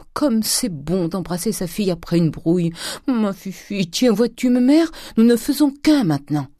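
high, delighted endearment; Grandet, effusive. A narrator's reading. Father embracing his daughter after a quarrel, effusive. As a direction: gush with rising delight.

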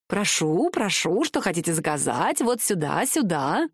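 lilting patter with swooping rises; fussy little shoemaker, welcoming. Acted. Master Serri seating new customers, fussy and welcoming. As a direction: usher customers in with bouncy rising phrase ends.

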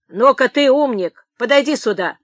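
commanding. A narrator's reading. Clerk summoning the old man, commanding. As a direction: order him over with force. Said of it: "приказал писарь".

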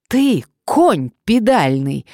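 high, loud, slow insult; angry bark. A narrator's reading. Woman to her blackmailer on the phone, barking angrily. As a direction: bark each word out in fury. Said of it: "рявкнула она на шантажиста".

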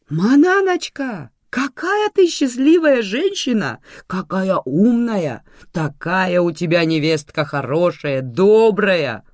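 slow, high, gushing praise; effusive exclamation. A narrator's reading. Sergo flatters Manana and her daughter-in-law, exclaiming. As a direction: lay the flattery on thick. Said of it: "воскликнул Серго".

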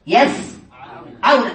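يسعون drawn out very slowly, split after the first syllable; teacher slowly separating the syllables of يسعون; broken into parts. Shown as a demonstration. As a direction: say يسعون in two slow parts with a long pause between. Teacher breaks word into parts.

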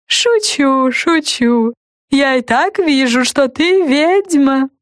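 high, teasing creaky voice; tease, then amused; talking lollipop. A narrator's reading. Lollipop character keeps talking.

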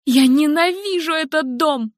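high, furious cry; screaming hatred. Acted. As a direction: hurl it out at the house. Helen screams hatred of house.

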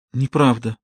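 ordinary delivery, nothing marked

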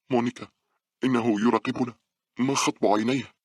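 high, anxious voice; fearful. A narrator's reading. Scared girl tells friend of the watcher, fearful. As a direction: call to a friend in fright. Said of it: "قالت باولا بخوف".

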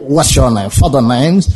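put-on "What's your name?" said low in a lazy, lip-only English accent; a teasing, deep-voiced send-up of a Western English accent; mock English speaker. Preacher mocks how English speakers only use their lips, in a low English accent. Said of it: "dia main bibir".

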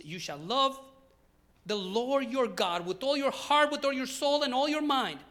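loud, slow proclamation of a commandment; scripture reading. Real, not acted. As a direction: preach the verse out with full force. Reads scripture aloud. (proclamación bíblica fuerte y pausada)

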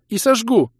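high, loud angry retort; high-pitched furious defiance; defiant threat. A narrator's reading. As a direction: snap back the threat at full voice. Kira answers the dare.

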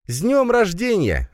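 high, drawn-out greeting; festive announcement. A narrator's reading. Masha greets birthday boy from doorway, announcing. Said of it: "объявила Маша с порога".

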